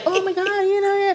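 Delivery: high, gushing mom voice; American mom. Retold or imitated. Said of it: "The American mom is like".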